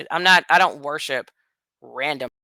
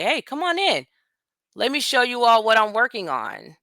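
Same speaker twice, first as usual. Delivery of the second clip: high, bright mock invitation; imitating Musk's welcome, voice up; mock welcoming host; 8 semitones higher than usual. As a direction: play the host who has nothing to hide. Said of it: "Why didn't you come to the door and say".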